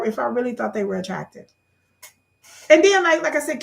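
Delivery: ordinary talk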